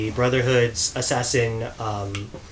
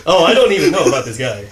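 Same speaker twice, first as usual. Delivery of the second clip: laughing; 5 dB louder, faster than usual. Real, not acted. Cosplayer laughs off Connor question.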